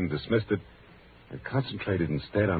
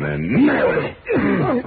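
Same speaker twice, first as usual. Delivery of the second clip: sudden climax; 7 dB louder than usual. A narrator's reading. Narrator springing the surprise on listeners, sudden climax. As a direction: pitch leaping high and loud.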